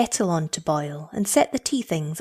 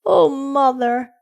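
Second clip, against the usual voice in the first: slow, high mock sob; drawn-out childish lament, louder; sobbed; 6 semitones higher, 5 dB louder, slower than usual. A narrator's reading. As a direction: wail it as an upset small child. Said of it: "she sobbed".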